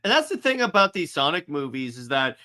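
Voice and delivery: usual speaking voice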